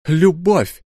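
high, loud cry of a single word; an outburst of passion, shouted. Acted. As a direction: fling the word out at him. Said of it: "выкрикнул Ра".

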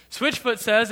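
usual speaking voice

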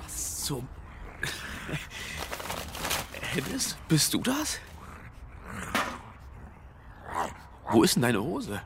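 imitating a startled neighbour. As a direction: act out stumbling on him at night. Imitates finding naked neighbour. (nachgespielter Schreck, hoch und gedehnt)

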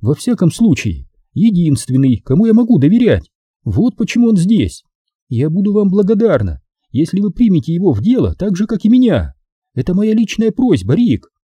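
high-pitched woman making a personal appeal; sweet-talking actress, pitched high; Maxine, gently appealing. Acted. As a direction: plead politely in a high female voice.

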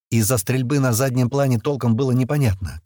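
ordinary delivery

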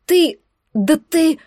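stammering indignant shock; speechless outrage. A narrator's reading. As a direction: choke on the words, lost for speech. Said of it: "я потеряла дар речи".

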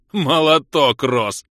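loud, hearty approval; chuckled cheer. A narrator's reading. Vlas getting up after being thrown, hearty amusement. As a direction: cheer him on with boisterous amusement. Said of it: "хохотнул Влас".

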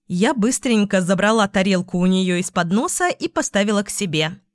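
ordinary delivery

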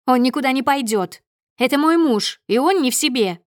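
loud, high, indignant wife; furious protest. A narrator's reading. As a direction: overrule your husband in front of strangers. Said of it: "её руки дрожат от ярости". (яростно, на повышенных тонах)

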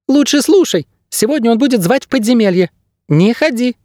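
very high, urgent little bird; squeaky bird guide. A narrator's reading. Bird guide warning his ward off, high squeak. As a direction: warn in a tiny squeak. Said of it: "ответил пернатый".

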